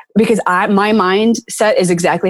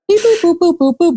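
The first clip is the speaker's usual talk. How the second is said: robot beeps; 11 semitones higher than usual. Retold or imitated. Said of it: "he's starting to, like".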